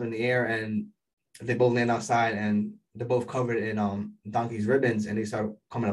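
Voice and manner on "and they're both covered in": ordinary talk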